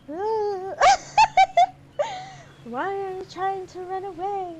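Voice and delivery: a hint of a spooky ocean calling. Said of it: "the ocean would sound like".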